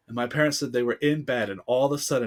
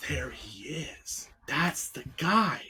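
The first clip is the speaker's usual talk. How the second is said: hushed, slow, awestruck whisper; awed whisper; 5 dB quieter, slower than usual. Retold or imitated. Child spotting the tape, in an awed whisper. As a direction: breathe it out in wonder.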